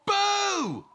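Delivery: high, loud cheeky boo; cheeky cub yelling boo; playful boo. Acted. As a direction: jump out with a big high boo. Cub scares animals.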